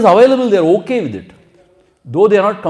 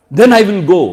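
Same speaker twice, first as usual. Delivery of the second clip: huffy threat to leave; 4 semitones higher, 9 dB louder than usual. Retold or imitated.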